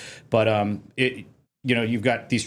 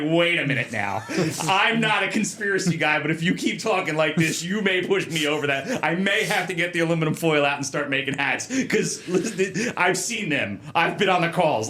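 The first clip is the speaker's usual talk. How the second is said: higher-pitched, incredulous objection; mock protest; 6 semitones higher than usual. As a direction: push back in comic disbelief. Acts out disbelief at official denial.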